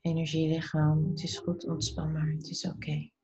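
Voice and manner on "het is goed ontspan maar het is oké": breathy soothing whisper; whispered reassurance. Acted. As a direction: whisper it softly to your body. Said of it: "zeg tegen dit deel van jouw lichaam".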